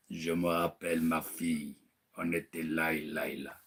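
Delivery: low hushed voice of a ghost; ghostly father. Retold or imitated. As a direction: speak like a dead father from beyond. Dead father's voice through medium.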